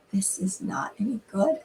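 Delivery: soft whisper of bad news; whispered verdict. A guest quietly admitting the casserole is bad, whispered. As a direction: breathe it out as a whisper.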